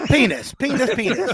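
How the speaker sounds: giggling through the word penis; laughing repeat. Acted. Teasing his co-host, he repeats penis while laughing.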